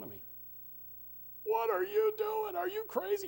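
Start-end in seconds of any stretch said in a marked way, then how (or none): 1.4-3.3 high mocking relative's voice; mock shocked relative. Relatives mocking his haircut.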